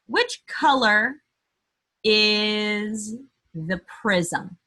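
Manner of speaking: put-on reading to a toddler. Slow drawn-out question to kids.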